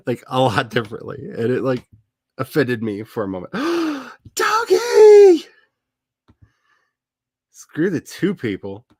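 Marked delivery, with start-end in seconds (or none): 3.5-5.4 very high, loud squeal; excited squeal. Real, not acted. Spotting a friend's dog on camera, delighted squeal.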